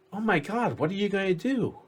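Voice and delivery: high, fast mock alarm; alarmed guard. The other guard gasps at his friend's news, alarmed and high. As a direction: panic a little, half-playful. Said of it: "guard dialogue".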